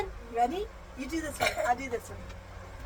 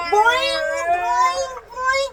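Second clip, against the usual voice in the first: higher bouncy boing sounds; playful boing; 6 semitones higher, 4 dB louder, much slower than usual. Pretend. Mother hopping the toy frog out, bouncy boings. He's gonna hop right out.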